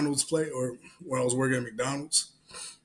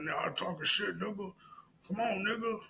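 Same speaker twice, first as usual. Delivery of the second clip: high, soft taunting imitation; mock challenger; 7 semitones higher, 5 dB quieter than usual. Homeless man challenging cashier at the register, taunting. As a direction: goad in a raised put-on voice.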